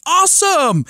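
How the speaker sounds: excited awesome. Retold or imitated. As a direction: cheer the word high. Dad's reply voiced.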